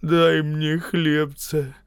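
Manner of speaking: high, very slow begging whine; beggar woman's voice. A narrator's reading. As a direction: plead like a beggar, dragging the words. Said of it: "сказала голосом нищей".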